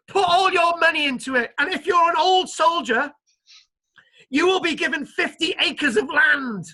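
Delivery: high, loud salesman's pitch, lightly voiced; company recruiter pitch. Company recruiting investors and old soldiers, high loud sales pitch. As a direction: sell the scheme to old soldiers. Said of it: "you can say".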